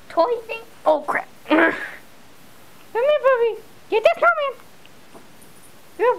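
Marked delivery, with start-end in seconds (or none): none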